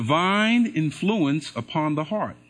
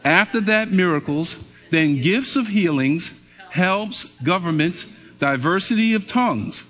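louder, slow, measured Bible reading; scripture reading; 2 semitones higher, 3 dB louder than usual. Acted. Pastor reading verses to the congregation, loud and measured. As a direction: proclaim each verse to the room.

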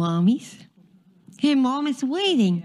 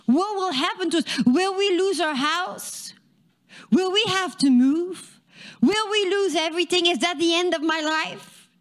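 much higher, fast, panicky questions; rising panic; 8 semitones higher than usual. Acted. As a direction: let the worry spill out high and rushed. Father's fears spiral upward.

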